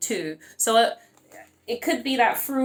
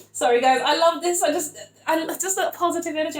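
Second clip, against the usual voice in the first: overcome with happiness; 6 semitones higher than usual. Real, not acted. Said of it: "it's making me feel so happy".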